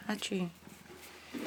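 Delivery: ordinary talk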